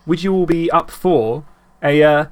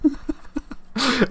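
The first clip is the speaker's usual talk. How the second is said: high laugh; 9 semitones higher, 6 dB quieter than usual. Real, not acted. Laughing at reindeer sleigh.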